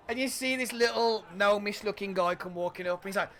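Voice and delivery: normal talking voice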